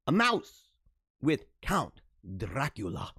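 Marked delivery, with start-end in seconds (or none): 1.6-3.1 slow, low mock Dracula accent. Host posing a riddle about Count Dracula, Dracula accent. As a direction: put on a hammy Dracula accent. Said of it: "That was my best accent".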